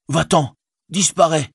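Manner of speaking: high, contemptuous dismissal; scornful Sam. Acted. Sam sends Gollum away, high and contemptuous. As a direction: drive the wretch away.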